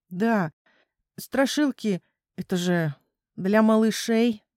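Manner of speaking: plain reading voice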